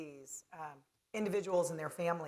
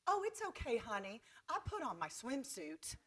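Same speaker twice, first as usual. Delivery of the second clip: lightly imitated Southern drawl, softer; mom's sweet Southern drawl, reassuring; a hint of Southern drawl, soothing her daughter; 4 semitones higher, 8 dB quieter than usual. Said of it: "she's this Southern drawl".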